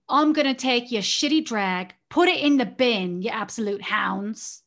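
imitating a British accent; mock British sass. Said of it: "the most British thing I've ever heard".

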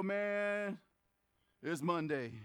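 low, downcast Monday groan; sagging, unenthused and dropping low at the end; weary reluctance. Acted. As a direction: say it like you dread getting up. Reluctant waking on Monday, contrasted.

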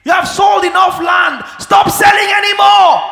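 high-pitched, full-voiced acted pleading; exasperated mock shouting. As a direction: shout it out as if begging givers to stop. Acts out refusing any more gifts.

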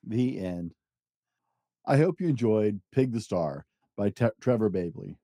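ordinary delivery

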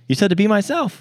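high-pitched mock self-defence; retold protest. Prankster defending himself to his date, retold as high protest. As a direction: plead innocence with the pitch up high.

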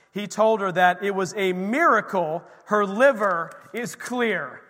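loud, fervent sermon voice; preaching. Acted. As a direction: preach the miracle to the room. Testimony preached with force.

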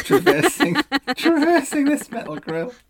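high delighted laugh on the words. Real, not acted. Delighted recounting snail shot.

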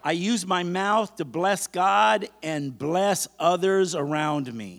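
slow, loud preacher's affirmation; emphatic, stretched-out call for repetition; leading a declaration. Acted. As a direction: proclaim it phrase by phrase for the congregation to echo. Leads a congregation in declarations.

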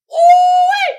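very high, loud ooh-wee whoop; excited whoop. Real, not acted. Vlogger hyping his audience, very high loud whoop. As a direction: let out a wild celebratory whoop.

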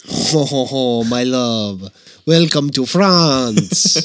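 slow mock-French lover; drawn-out French-accented welcome; gritty French accent. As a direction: put grit into a stagey French voice. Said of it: "the grit in your voice".

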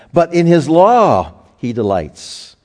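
"law" punched high and loud, the line slowed; stress on LAW. Shown as a demonstration. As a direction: hold on "law" and let it fall slowly. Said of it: "the law is put at the beginning of the sentence".

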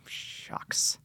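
breathy whispered "Shucks" to himself; sheepish whisper before owning up. Retold or imitated. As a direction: murmur it under your breath, embarrassed. Embarrassed aside before confessing.

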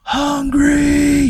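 very high, drawn-out pretend yell; mock yelling. Horny Hank calling for his brother, stretched mock yell. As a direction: wail the name like a last call for help. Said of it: "he just starts yelling".

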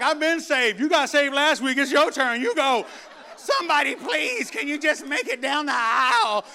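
loud, high, pushing a friend forward; laughing plea. Retold or imitated. Churchgoer pushing a friend who got saved last week, loud and laughing. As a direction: nudge your friend to the altar, half laughing. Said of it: "You're like".

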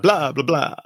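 Romanian vampire blah blah blah, lightly hammed; a touch of a Transylvanian count brushing it off; mock Romanian vampire accent. Host answering co-host's jab about Halloween, mock Romanian vampire accent. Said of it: "I appreciate both the accent and the rolled R".

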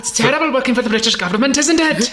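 quick, hammed-up Welsh lilt; mock Welsh chattiness; Welsh accent. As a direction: put on a comic Welsh accent. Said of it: "my man has a Welsh accent".

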